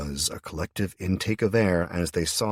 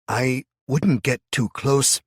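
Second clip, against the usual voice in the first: slow, loud, higher warning; 8 semitones higher, 7 dB louder, slower than usual. A narrator's reading. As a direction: caution her off drawn out. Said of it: "he started to say".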